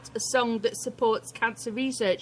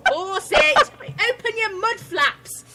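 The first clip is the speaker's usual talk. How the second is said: mock exasperated husband; 10 semitones higher, slower than usual. Retelling husband's exasperation, mock yell. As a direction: bellow it like a frustrated husband.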